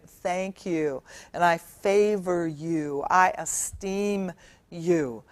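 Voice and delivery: soft, slow sincere thanks; quiet, unhurried little thank-you; grateful child. Retold or imitated. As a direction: say it gently, like a thankful kid.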